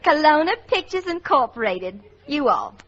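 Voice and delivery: hammed-up Southern belle accent. Secretary reading a dictated letter back to her boss, in a Southern accent. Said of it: "a girl who talks like a Southerner".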